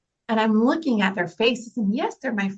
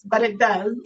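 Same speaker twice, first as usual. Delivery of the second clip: laughing through tears; 3 semitones higher than usual. Real, not acted. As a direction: let the laugh break out half crying.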